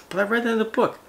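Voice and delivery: high-pitched mimicry of a protest; mock whiny-kid objection; mocking objector. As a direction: throw their excuse back in a high voice. Mimics an objector, then rebuts.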